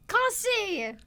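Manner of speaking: high desperate scream of a name. Acted. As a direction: scream the name across the yard. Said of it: "She screams".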